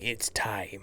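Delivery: low breathy whisper; soft, mock-mystical hush; hushed old-master impression. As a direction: whisper it like a solemn pronouncement. Retold solemn line of old master.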